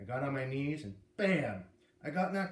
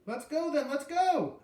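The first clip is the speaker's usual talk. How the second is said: high, loud, bursting to go out; let's go, can't wait, pitched high and loud; eager shout; 13 semitones higher, 7 dB louder, slower than usual. A narrator's reading. Eager kid urging to go.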